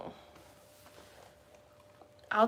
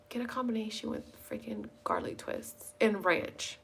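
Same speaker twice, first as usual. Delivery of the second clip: whisper; 11 dB quieter than usual. Real, not acted. Craving pizza, whispering to camera.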